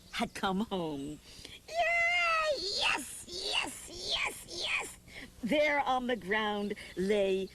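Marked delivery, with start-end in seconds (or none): 1.7-2.6 excited cheer. Acted. Child finding his lost teddy, a high joyful cheer. 2.8-5.0 excited whisper. Acted.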